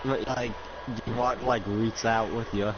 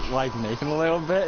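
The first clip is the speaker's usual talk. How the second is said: normal talking voice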